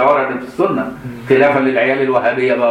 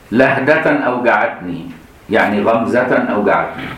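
usual speaking voice

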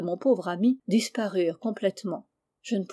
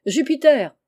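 higher, louder urgent call; raised voice, pitched up to carry; 5 semitones higher, 8 dB louder than usual. A narrator's reading. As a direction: call up to someone in a tree. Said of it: "criait-il, sans m'écouter".

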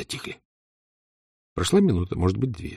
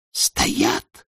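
barely audible whisper. Acted. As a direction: breathe it out, hushed. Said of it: "чуть слышно ответила Таня".

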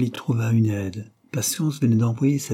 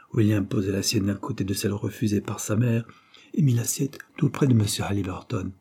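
ordinary delivery, nothing marked

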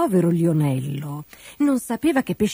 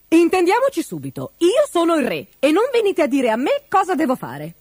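high-pitched, imperious outburst; bossy royal scolding; indignant king; 8 semitones higher than usual. A narrator's reading. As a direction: put them in their place, voice raised high. King asserts his authority.

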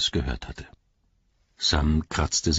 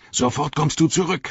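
shouted; 10 semitones higher than usual. A narrator's reading. Sam shouting after Gollum, much higher. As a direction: yell after him to come back. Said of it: "schrie Sam".

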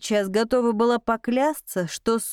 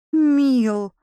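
reproachful call; 3 semitones higher, 4 dB louder than usual. A narrator's reading. Repeated reproachful calls.

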